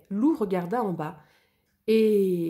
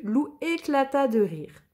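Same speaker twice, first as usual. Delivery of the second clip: usual speaking voice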